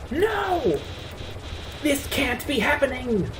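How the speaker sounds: high, wailing villain in disbelief; Flowey in panic. Acted. Game villain seeing it go wrong, panicked. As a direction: cry it out high and appalled.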